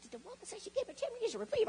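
high, fast, incredulous squeal; stunned disbelief. Retold or imitated. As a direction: she can't believe what she just heard. Shocked at the tax debt.

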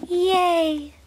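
high squeaky pony yay; a toy pony squeaking with joy; pony's cheer. Pretend. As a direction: voice the little pony cheering happily. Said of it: "what she has to say about that?".

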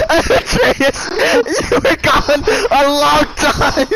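dramatic accusation. Acted. Dramatic roleplay line.

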